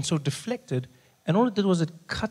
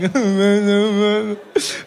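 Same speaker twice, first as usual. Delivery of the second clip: exaggerated drawn-out wailing cry; higher, hammed-up bawling; mock sobbing; 5 semitones higher, 3 dB louder than usual. Grown son crying at mother's bedside, mock sobbing. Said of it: "So I'm crying for everything".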